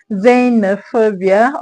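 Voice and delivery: Xenophobia said slowly and deliberately; careful slow Xenophobia for students; slow demonstration. Shown as a demonstration. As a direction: lay out Xenophobia slowly like a teacher. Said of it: "how can we stress xenophobia".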